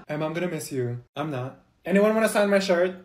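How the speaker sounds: normal talking voice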